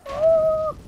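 high, loud startled "ah"; startled cry. Real, not acted. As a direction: yelp as the game catches you out. Startled by the game. (forskrækket udråb)

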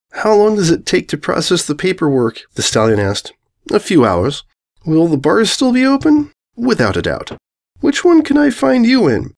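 an apologetic newcomer fumbling for words; awkward stallion. Acted. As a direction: Spark explains himself to the princesses. Spark voiced again.

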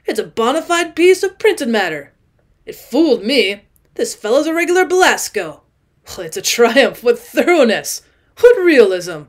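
loud, exultant tipsy crowing; triumphant drunk man. Acted. Drunk guest showing off a book, loud and triumphant. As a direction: crow over the real books.

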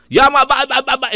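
high, loud stammering pupil whine; a kid sputtering excuses back at the teacher; whiny pupil protest. Retold or imitated. Teacher retells pupil whining back at him, high and loud.

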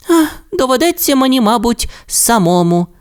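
high, fast, pleading little dinosaur voice; sad little Timmi. A narrator's reading. Timmi sighs to Rasmus, high and mock-sad. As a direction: sulk like a small child who must go alone. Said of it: "зробив дуже сумні очі".

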